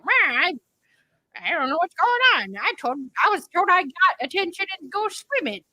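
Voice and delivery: playing her confused younger self; eight-year-old me. Remembering her baptism as a confused kid, childlike. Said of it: "Sound like you're seven years old".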